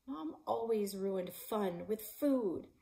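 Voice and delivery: usual speaking voice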